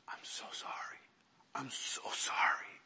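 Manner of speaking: whispered, choked-up apology; imitated tearful apology. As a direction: whisper it as if close to tears. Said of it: "he has tears in his eyes".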